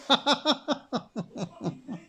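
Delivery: a squealing rising giggle; high-pitched laugh. Real, not acted. Cracking up at chat, high squealing laugh. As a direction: let a high laugh rise up. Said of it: "I'm laughing my ass off".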